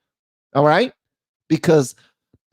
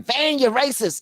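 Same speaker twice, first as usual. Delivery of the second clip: high-pitched mocking critic voice; mock accuser; 11 semitones higher than usual. Host imitating viewers who will accuse him, high mocking voice. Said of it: "I'm about to pull some voices out".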